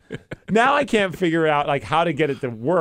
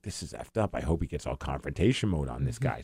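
quiet, low-pitched aside; retold low aside; 11 semitones lower, 9 dB quieter than usual. Wife commenting on the confrontation, low aside. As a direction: voice the wife dropping her voice, much softer and lower.

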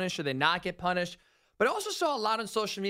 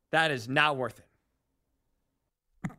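coughing fit breaking the words; words cut by a cough; 5 semitones lower, much slower than usual. Real, not acted. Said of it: "Sorry, it's not me gagging. It's me coughing".

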